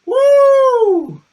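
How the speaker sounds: high shouted whoop; loud whoop. Real, not acted. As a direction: whoop to shake off the pain. Shaking off the burn.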